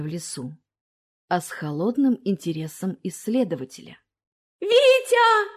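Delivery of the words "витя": high, loud ringing tearful cry; tearful frightened cry. A narrator's reading. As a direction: cry out through frightened tears. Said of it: "звенящий испуганными слезами голос".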